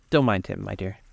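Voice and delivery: ordinary delivery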